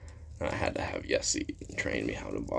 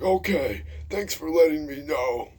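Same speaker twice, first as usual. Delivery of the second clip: high, loud old-teacher imitation; Mister Will; 8 dB louder than usual. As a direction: holler thanks as a worked-up old teacher. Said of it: "He's like".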